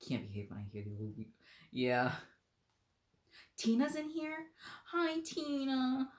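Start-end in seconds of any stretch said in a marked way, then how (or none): 3.6-6.1 high, drawn-out delighted hello; excited greeting. Real, not acted. Reader greeting Tina in the chat, high and delighted. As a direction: greet a friend happily.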